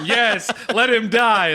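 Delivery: loud, eager cry for blood; cheering for a kill. Retold or imitated. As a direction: yell it like a bloodthirsty fan. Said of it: "both of us are like".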